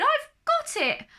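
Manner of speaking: high, bright exclaiming snail voice; excited cry. Acted. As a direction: squeak it out with sudden joy.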